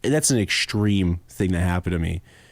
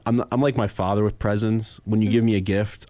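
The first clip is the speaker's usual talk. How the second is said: normal talking voice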